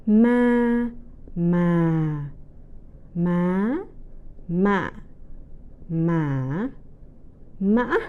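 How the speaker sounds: "Ma" repeated, each with a different tone: level, falling, rising, heavy, broken; tone drill. Shown as a demonstration. Teacher recapping all the tones for learners, careful tone drill. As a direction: run "Ma" through the tones, pausing between each. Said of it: "speak all the tones again".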